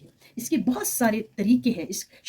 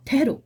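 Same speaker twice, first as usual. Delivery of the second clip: ठहरो raised and stressed, then a short comma pause; pause after ठहरो; 7 semitones higher, 7 dB louder than usual. Shown as a demonstration. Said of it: "वक्फ़े खफीफ है, ठहरना है".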